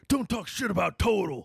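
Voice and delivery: high, loud mock yell; quoting a favorite meme as a shouted impression; imitating a shout. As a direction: bark it like the meme guy. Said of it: "he always be like".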